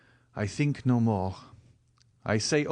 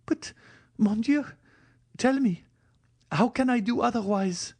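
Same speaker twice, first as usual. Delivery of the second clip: much higher, pleading voice; desperate girl; 13 semitones higher than usual. Acted. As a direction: beg him for an answer, voice soaring. Valentine in despair.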